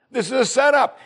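high alarmed repeat; alarmed again. Retold or imitated. King of Israel insisting it is a trap, high alarmed.

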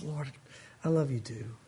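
soft, low, tender answer to God; tender reply. Pretend. Believer answering God's love, soft and tender. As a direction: murmur it like a heartfelt reply. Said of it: "What's your response?".

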